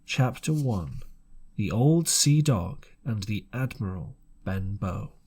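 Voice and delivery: usual speaking voice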